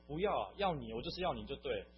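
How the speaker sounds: loud re-enacted refusal; insistent. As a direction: imitate someone pushing back firmly. Quoted argument, insisting he lead prayer.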